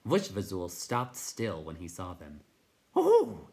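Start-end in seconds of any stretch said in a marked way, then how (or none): 2.9-3.5 very high, loud hoot; astonished night hob. Acted.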